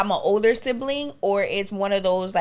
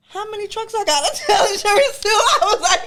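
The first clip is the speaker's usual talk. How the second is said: laughing; 11 semitones higher than usual. Retold or imitated. Retelling joke to brother, laughing.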